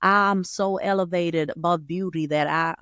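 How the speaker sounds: slow, badly faked Texan drawl; hammed-up Texan accent, drawn out. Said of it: "like, a Texan accent".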